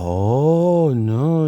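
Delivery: swooping retold gasp of dismay; shocked mother. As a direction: imitate a mother's horrified reaction, stretching the vowels. Mom's shocked reaction acted out.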